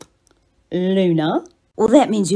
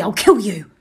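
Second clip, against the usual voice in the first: usual speaking voice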